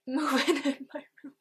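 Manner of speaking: high, loud words through a laugh; laughing at the spooky balloon. Real, not acted. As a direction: laugh while pointing it out.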